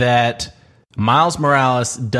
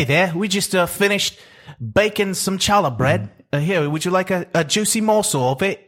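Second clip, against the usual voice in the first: lightly higher mock British shopkeeper voice; British baker; 8 semitones higher than usual. Baker offering fresh challah to a customer, light mock British accent. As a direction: play a friendly British baker offering bread, a bit higher. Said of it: "try saying it with a British accent".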